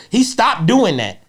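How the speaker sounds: ordinary talk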